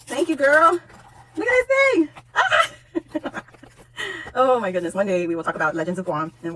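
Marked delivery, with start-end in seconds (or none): 1.4-2.1 high squeal of delight; thrilled, high-pitched; excited squeal. Real, not acted. Thrilled by gift. 2.4-3.4 very high short squeal; high happy yelp; delighted shriek. Real, not acted. Screams over gift.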